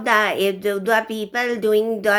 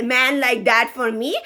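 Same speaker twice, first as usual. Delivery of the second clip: ordinary talk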